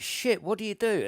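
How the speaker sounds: high-pitched panicked question; panicking. Retold or imitated. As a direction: act out sudden panic. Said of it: "There's people just panicking now".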